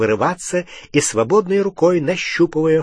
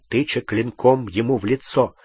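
plain reading voice

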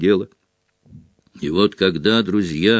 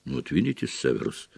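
low, soft and mild; quiet, settled reassurance; peaceful; 7 semitones lower, 10 dB quieter, faster than usual. A narrator's reading. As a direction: say it placidly. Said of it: "мирно произнёс Дамблдор".

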